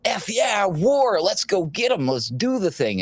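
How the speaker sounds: high, gung-ho mock cheer; mocking warmongers. Host mocking the same politicians now cheering for war. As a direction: ham up the eager warmonger. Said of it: "these exact same people are like".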